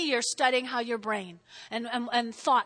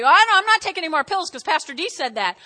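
loud, higher mock patient voice; defiant patient; 4 semitones higher, 8 dB louder, faster than usual. Congregant refusing the doctor's pills, mock defiant.

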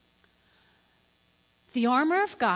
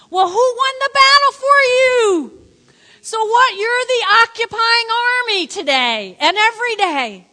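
high, loud pulpit shout; rousing preaching; 7 semitones higher, 10 dB louder than usual. Real, not acted. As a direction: fire up the congregation at full voice. Preacher rouses congregation with rhetorical challenge.

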